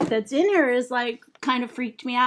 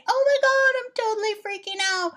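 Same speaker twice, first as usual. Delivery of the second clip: squealing freak-out; 10 semitones higher, faster than usual. Acted. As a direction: squeal in panic over the boy talking to you. Inner panic, valley girl.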